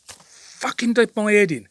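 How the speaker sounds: slow, loud, high real fury; real furious slow exclamation; furious outburst. Real, not acted. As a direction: spit it out in real anger. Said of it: "I'm getting all fucking worked up now".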